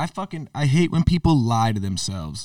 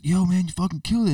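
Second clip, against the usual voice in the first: excited fan; 8 semitones higher than usual. Retold or imitated. Fan praising after show.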